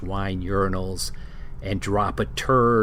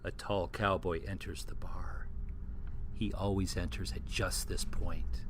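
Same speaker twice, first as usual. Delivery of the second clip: hushed whispered narration; 8 dB quieter than usual. Acted. Narrator whispering as the cowboy enters the bar. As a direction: whisper it like a secret.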